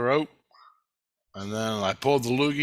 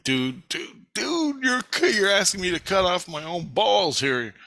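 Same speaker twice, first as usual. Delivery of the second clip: exasperated protest; 6 semitones higher, slower than usual. Retold or imitated. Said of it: "I'm like".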